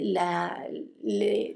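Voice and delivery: ordinary talk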